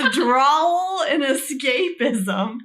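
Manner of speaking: cracking up while reading out her supposed strengths; laughing. Real, not acted. Laughing as she lists her bad strengths to her friend.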